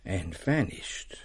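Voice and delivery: slow whisper, letting the word fade; whispered vanishing. Acted. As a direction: breathe it out like the ghost disappearing. Ghost story climax.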